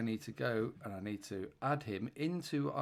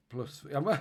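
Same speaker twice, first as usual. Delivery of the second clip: ordinary delivery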